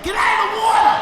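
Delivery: high, very loud screaming warning. Retold or imitated. Comic screaming at the crowd from the stage, very high and loud. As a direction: scream it like a lifeguard. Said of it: "Even I'm screaming".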